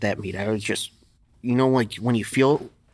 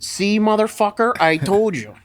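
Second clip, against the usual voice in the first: gloating coach; 8 semitones higher, 4 dB louder than usual. Retold or imitated. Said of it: "Lou said".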